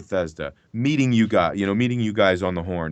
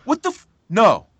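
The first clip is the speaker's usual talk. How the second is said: high, loud shocked outburst; stunned disbelief; 10 semitones higher, 8 dB louder than usual. Retold or imitated. Actor re-living discovering the plot twist, shocked high cry. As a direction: voice jumps up in sudden shock. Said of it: "recreation and reperformance of it".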